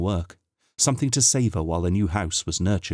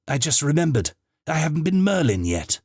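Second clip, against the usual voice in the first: excited; 8 semitones higher than usual. Acted. The Doctor pops his head back out of the tardis, excited and higher. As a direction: blurt it out in a rush of delight.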